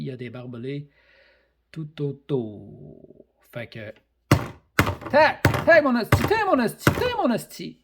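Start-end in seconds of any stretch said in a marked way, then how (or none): 5.1-7.8 loud high yells on each hit; fight yell. Acted. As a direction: shout every blow like a brawl. Acting out a wrestling weapon attack.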